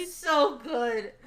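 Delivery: gushing. Real, not acted. Gushing after the chapter.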